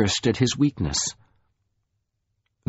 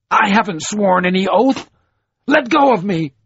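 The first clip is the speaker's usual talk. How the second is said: angry protest; 11 semitones higher, 5 dB louder than usual. A narrator's reading. Troy struggles against his captor.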